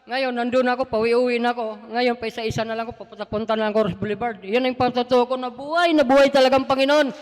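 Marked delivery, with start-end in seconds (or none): 4.4-7.1 loud, higher closing praise; loud fervent closing of a church testimony. Real, not acted. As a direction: proclaim faith with full force.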